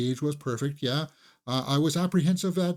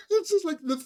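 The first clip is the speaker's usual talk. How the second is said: laughing. Real, not acted. Recalls theater full of laughter.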